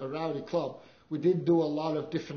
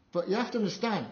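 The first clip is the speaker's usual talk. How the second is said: ordinary talk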